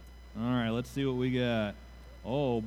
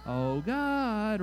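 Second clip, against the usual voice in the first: put-on "Oh, God" lifted much higher, like a child's call; 7 semitones higher than usual. Shown as a demonstration. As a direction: cry it out the way Mickey calls Toodles. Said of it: "Can anyone say, "Oh, God"?".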